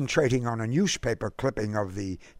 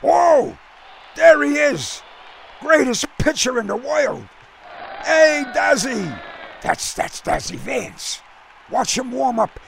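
higher, louder fan calling out to the field; excited cheering; 10 semitones higher, 3 dB louder than usual. A narrator's reading. Jack cheers Dazzy from the stands, excited and loud. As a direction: hail your hero across the stands.

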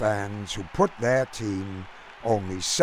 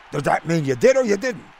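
fast, higher-pitched local pressing for an answer; Brooklyn fan; 5 semitones higher, 4 dB louder, faster than usual. A narrator's reading. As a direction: press him like a baffled cabbie. Fan presses the puzzling stranger.

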